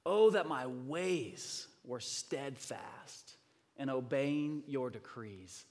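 slow, weighty public reading; scripture reading. Real, not acted. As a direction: proclaim the psalm phrase by phrase with pauses. Reads the psalm aloud to congregation.

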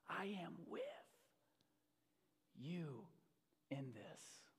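soft, near-whispered words with long pauses; hushed assurance. Acted. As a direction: let each phrase settle quietly. Softly repeats God's words.

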